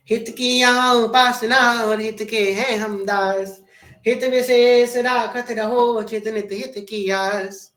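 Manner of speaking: solemn, drawn-out recitation of a couplet; slow couplet recitation. Acted. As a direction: intone the verse slowly and devotedly.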